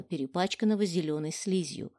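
ordinary delivery, nothing marked

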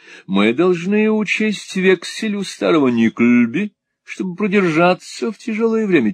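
high, affected persuasion; foppish scheming husband. A narrator's reading. Husband after money, high affected persuasion. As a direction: propose it airily at a high pitch.

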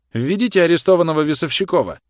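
high, loud officer's command; shouted order. A narrator's reading. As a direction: bellow the order to your men. Officer shouts an order to his men.

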